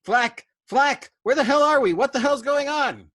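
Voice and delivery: shrieking. Acted. McWatt screaming at the crew under flak, panicked. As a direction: scream it over the intercom in terror. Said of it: "McWatt was shrieking over the intercom".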